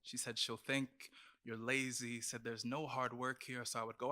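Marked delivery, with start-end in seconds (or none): none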